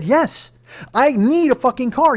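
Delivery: loud and high, worked up; exasperated husband venting about car dealers; heated retelling. Retold or imitated. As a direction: vent it like you're fed up. Retold exasperated speech to wife.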